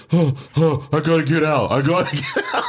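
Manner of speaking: high-pitched, gasping panic act; hammed-up claustrophobic panting, desperate to escape; mock freaking out. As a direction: imitate someone panicking for air. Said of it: "freaking out".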